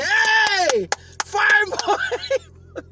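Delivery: loud high call across the street; hype shout. Retold or imitated. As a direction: shout it like a neighbour calling out. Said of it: "They just say".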